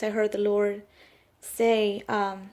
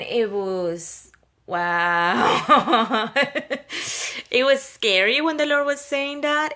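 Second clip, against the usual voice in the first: overcome; 4 dB louder, much slower than usual. Real, not acted. Overwhelmed recalling the stern word.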